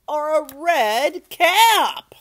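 slow right down and belt out "cap"; loud, stretched finish on "cap"; climactic list ending. A narrator's reading. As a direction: CAP drawn out loud and high.

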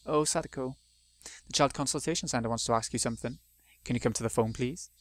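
ordinary delivery

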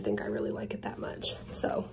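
hushed aside about the dress; whispering. Real, not acted. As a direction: drop to a whisper. Aside while trying on dress.

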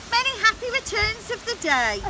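high, cheerful knightly greeting; birthday wish. A narrator's reading. Knight giving the princess her present, wishing her happy birthday. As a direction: offer a bright birthday wish, pitched high.

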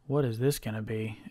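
ordinary delivery

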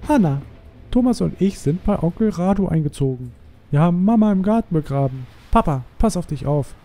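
high put-on reading voice; attempted character voice. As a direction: read the writing aloud in a clumsy high voice. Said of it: "Ich kann keine Stimmen imitieren".

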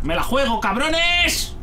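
high, drawn-out daring cry; defiant yell. Real, not acted. Player to the enemies before peeking out, defiant yell. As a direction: throw it out recklessly, rising high on the last word. Stepping out despite the risk.

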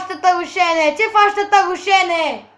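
ordinary delivery

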